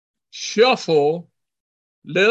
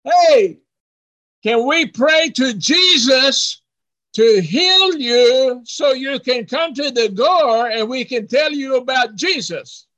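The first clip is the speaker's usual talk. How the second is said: high, carrying call through a door; hollered request; 6 semitones higher, 3 dB louder than usual. Retold or imitated. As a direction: open loud, then call it out at length. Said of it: "And he hollered".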